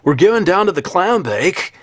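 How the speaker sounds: high, loud, stretched surfer voice; surfer dude. Retold or imitated. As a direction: hype it like a beach bum. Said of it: "the voice of a surfer dude".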